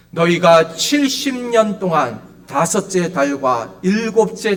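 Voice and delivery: slow, emphatic pulpit reading; solemn Bible recitation, loud on key phrases; scripture reading. Acted. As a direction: proclaim the verse phrase by phrase, drawing out endings. Reads the Bible passage aloud.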